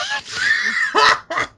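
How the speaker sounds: shrieking laugh. Real, not acted. Laughing at the comeback.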